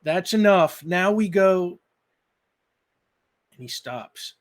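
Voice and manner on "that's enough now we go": loud, higher call down from the air; louder call to end the game. Acted. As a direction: call down to her from above. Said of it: "he calls out".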